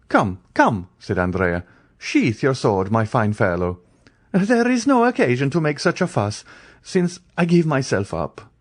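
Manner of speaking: high ironic ease; mock surrender. Andrea teasing the brigadier, breezy and ironic. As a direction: give up with a smirk.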